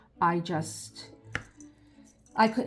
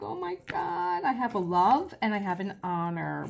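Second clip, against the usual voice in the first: gushing; 3 semitones higher, 4 dB louder than usual. Retold or imitated. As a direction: swoon over the dogs out loud. Gushing to the stranger about dogs.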